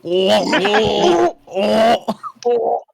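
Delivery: gagging, voice pushed up; retching noises between words. Real, not acted. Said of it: "I actually started gagging".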